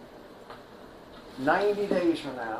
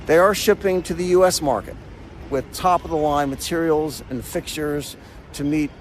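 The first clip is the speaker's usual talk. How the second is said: steady, clear narrator read; polished explainer-video narration; documentary voiceover; 7 dB louder than usual. Acted. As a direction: present the facts like a documentary host.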